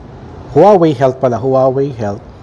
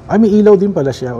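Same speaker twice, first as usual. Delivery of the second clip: quick, higher exclamation of discovery; pleasant surprise; 8 semitones higher, 4 dB louder, faster than usual. Real, not acted. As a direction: react fast, as if just noticing it. Notices the watch lights up. (nagulat na bulalas, mabilis)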